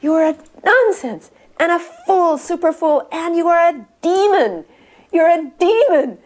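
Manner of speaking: high, loud, slow imitation of a guru's rebuke; retold fierce denunciation. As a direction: draw out each accusation forcefully. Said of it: "leaned over and he says".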